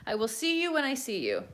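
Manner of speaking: usual speaking voice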